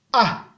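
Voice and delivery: A short, dropping to a low tone. Shown as a demonstration. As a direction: drop A briefly to the bottom. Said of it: "Ton bas". (« A » au ton bas)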